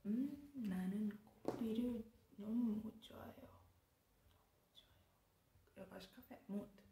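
fast whispered example sentence; whispering. Shown as a demonstration. Sharing her coffee love with viewers, whispered. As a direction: breathe the line out as a secret.

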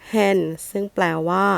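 normal talking voice

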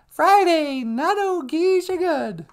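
very high puppet-like voice; high-pitched comic voice; squeaky sidekick. Pretend. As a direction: pipe it out high and slow. High sidekick repeats the phrase.

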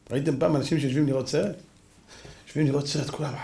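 normal talking voice

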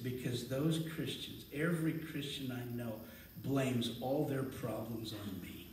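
a devil sulking to a preacher, understated and low; self-pitying devil. Retold or imitated. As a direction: play the devil moping over his bad day. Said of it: "Here's what the devil said".

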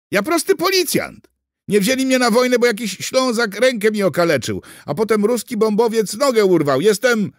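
high, loud pleading in panic; frightened outburst. A narrator's reading. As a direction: plead your case at the top of the voice. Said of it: "Kuger się przestraszył". (wystraszone, głośne tłumaczenie się)

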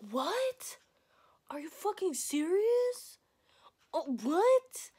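high, rising disbelief; imitated stunned outburst; sister's shock. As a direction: draw it out in incredulous high pitch. Sister reacting to pregnancy news.

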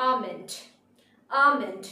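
"আমন্ড" as AH-mend, no l; silent l. Shown as a demonstration. Teacher presenting the next word, "আমন্ড" as AH-mend. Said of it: "spelling আর pronunciation different".

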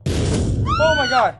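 loud high slow shout. Real, not acted. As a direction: yell it in shock. Scared again.

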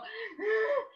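high hitching sob; imitated child's crying gasp; sobbing gasp. Child crying at customer service, gasping sob.

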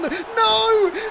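loud high despairing no; anguished cry. Real, not acted. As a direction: cry out as the goal goes in. Concedes an equaliser.

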